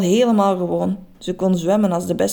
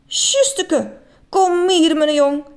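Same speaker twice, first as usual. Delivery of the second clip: high, louder granny; grandma voice; 8 semitones higher, 3 dB louder, slower than usual. A narrator's reading. Grandma welcoming her grandson in the water, high and loving. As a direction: welcome him home.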